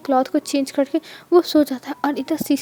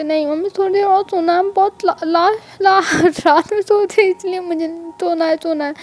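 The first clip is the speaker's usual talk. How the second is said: put-on sleepy whining child; 4 semitones higher than usual. Kids begging mom to sleep.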